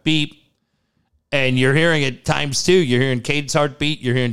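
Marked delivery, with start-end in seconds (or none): 0.0-0.4 a short, slightly raised beep, like a heart monitor; lightly voiced machine beep; imitated monitor beep. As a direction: mimic the hospital beep with the word beep. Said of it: "It's just that beep".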